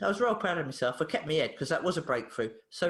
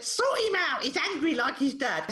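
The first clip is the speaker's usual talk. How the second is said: exasperated mum; 8 semitones higher than usual. Retold or imitated. Retold exasperated mum dropping boy off.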